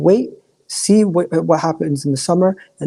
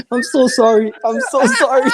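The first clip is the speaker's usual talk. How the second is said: coughing, voice cracking high; coughing fit; 8 semitones higher, slower than usual. Real, not acted. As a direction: apologize through a coughing fit. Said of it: "my throat is, like, done today".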